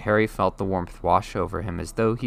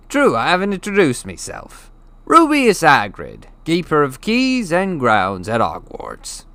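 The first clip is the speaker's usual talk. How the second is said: proud introduction; 8 semitones higher, 8 dB louder than usual. Acted. Hagrid introduces himself to Harry, proudly. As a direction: announce your title with pride.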